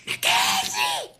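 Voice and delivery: full-voiced, very high scream; desperate long-drawn call. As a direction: scream a name at the top of your voice. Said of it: "I'm screaming on top of my voice".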